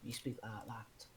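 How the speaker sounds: hushed aside; whisper. Real, not acted. Telling friends about accents, whispered. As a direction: drop to a whisper.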